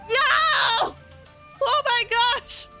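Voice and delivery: high excited squeal; thrilled high cry. Real, not acted. Excited reaction to Jester speaking.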